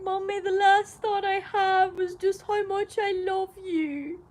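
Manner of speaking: slow, high, drugged sentimental voice; high after surgery. Retold or imitated. Daughter phoning mum high after surgery, sentimental. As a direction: imitate yourself gushing woozily down the phone. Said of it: "high after the surgery".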